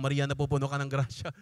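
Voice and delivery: low, level recited prayer; murmur-like Hail Mary recital at normal volume; imitated rosary prayer. (ginagayang mababang pagdarasal ng rosaryo)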